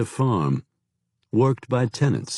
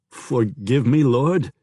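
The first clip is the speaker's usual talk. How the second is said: brokenly; 4 semitones higher, slower than usual. A narrator's reading. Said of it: "the local said brokenly".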